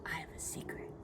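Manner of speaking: imitating a child's hushed whisper; whispered secret. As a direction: drop into a very soft, voiceless whisper. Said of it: "lean in and say".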